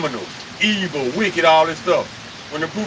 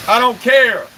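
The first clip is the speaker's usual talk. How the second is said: louder and higher, flinging "I don't care"; defiant outburst; 5 semitones higher, 4 dB louder than usual. Real, not acted. Host brushing off his online critics, raised defiant voice. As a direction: throw it at the critics, raised and sharp.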